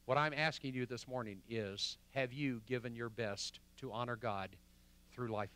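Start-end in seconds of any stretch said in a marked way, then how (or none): none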